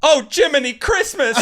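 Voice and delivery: put-on high, loud startled old-fashioned gasp of a mom; flustered mom exclamation. A friend's mom hearing a tree is burning, high startled exclamation. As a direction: squeal it like a flustered mother hearing bad news. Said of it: "And she goes".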